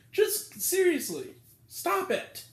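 furious plea to stop; angry outburst. Real, not acted. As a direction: snap it at her. Ranting at the annoying moviegoer.

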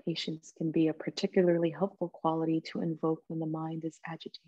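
soft, calm meditation voice with long pauses; guided meditation. Real, not acted. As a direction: gently guide them into stillness, leaving space between phrases.

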